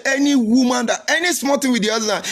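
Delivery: high, laughing mock voice of an old father; imitating his father. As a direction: play the father half laughing as he warns. Father quoted in a story.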